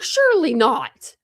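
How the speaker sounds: slow, high, loud incredulity; re-enacted disbelief. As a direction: draw it out high, acting out disbelief. Acting out her disbelieving reaction.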